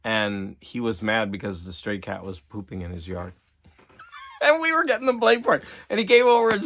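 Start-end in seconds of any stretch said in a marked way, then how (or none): 3.6-6.6 laughing through the words, pitched high and fast. Real, not acted. Telling viewers about the cat feud, laughing high and fast. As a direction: giggle out the injustice quickly.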